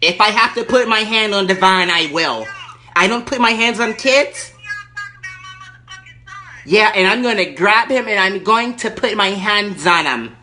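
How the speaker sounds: high, loud irate lady caller; Erkenbacher. Acted. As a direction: play the furious entitled lady on the phone. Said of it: "Erkenbacher calling back".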